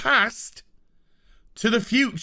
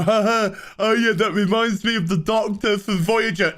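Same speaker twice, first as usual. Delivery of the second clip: mock viewer reaction; 4 semitones higher than usual. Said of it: "you're meant to go".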